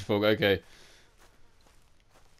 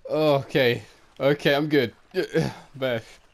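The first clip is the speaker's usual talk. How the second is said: normal talking voice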